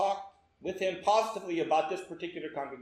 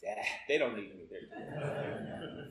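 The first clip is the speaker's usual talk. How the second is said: low, slow, soft shrug-off; dismissive mutter; 10 semitones lower, 9 dB quieter, slower than usual. Retold or imitated. Preacher to himself at the rainy front door, dismissive. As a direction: mutter it low and lazily to yourself. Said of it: "I'm like".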